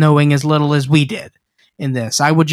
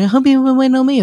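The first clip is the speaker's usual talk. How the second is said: mock Russell; 10 semitones higher, much faster than usual. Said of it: "He's like".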